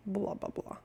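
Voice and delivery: dismissive whisper, trailing off; whispered blah blah. Real, not acted. Waving off the heat talk, a quick whispered aside. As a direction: brush it off under your breath.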